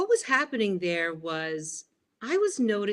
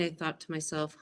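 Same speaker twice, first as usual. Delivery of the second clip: ordinary delivery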